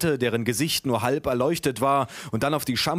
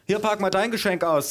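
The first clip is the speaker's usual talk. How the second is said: higher-pitched grandma handing out a present; voiced grandma; 7 semitones higher than usual. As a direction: voice Grandma urging from above your usual pitch. Grandmother's line in the reading. (Oma mit höherer Stimme)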